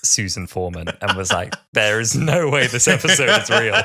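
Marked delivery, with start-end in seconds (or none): 1.7-3.7 raised, louder, incredulous laughing reaction; mock disbelief. Podcaster quoting an online viewer's reaction, mock disbelief. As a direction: quote it as a baffled fan would.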